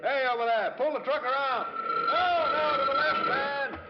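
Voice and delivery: hollered directions to a driver; calling out orders. Acted. Major directs a truck at the levee, calling out. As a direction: holler across a noisy work site.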